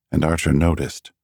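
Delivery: plain reading voice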